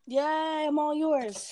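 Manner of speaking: playful cheer. Real, not acted. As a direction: sing it out happily to her friend. Playful teasing with cohost.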